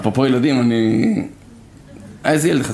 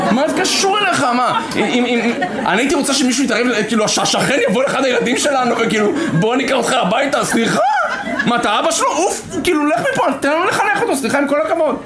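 higher, laughing mock-indignant wife; scolding wife; 7 semitones higher than usual. Wife telling her husband to stop meddling, higher and laughing. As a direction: tell him off for meddling, half laughing.

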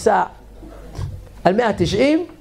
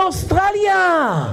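proud laughing shout; 8 semitones higher, much slower than usual. Acted.

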